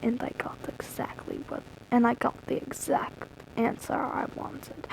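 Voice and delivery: breathy whisper, barely any voice. A narrator's reading. Reader re-reading the line to the audience, whispered. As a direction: hush it like a secret. Oh, wait.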